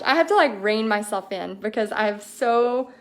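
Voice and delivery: ordinary delivery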